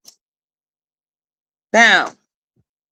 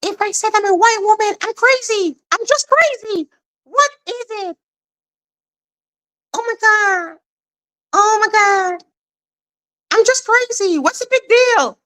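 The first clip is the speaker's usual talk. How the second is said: high, giddy cartoon-crazy voice; a lightly hammed-up madwoman; mock crazy voice; 7 semitones higher than usual. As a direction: play the loony, pitched way up. Continues mock crazy persona.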